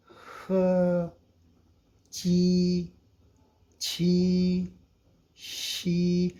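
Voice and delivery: each initial sounded out slowly like a tutor; crisp Mandarin-style syllables, one by one; Chinese initials drilled. Shown as a demonstration. Teacher reciting Chinese initials for Urdu learners, each sound isolated. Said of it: "इन, ये finals.".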